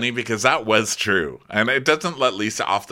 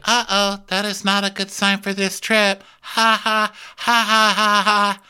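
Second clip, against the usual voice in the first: high-pitched mock worry into fake laughter; high, hammed-up voice ending in a staccato "ha ha"; put-on cast member voice; 8 semitones higher than usual. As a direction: imitate her high, forced laugh. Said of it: "She's like".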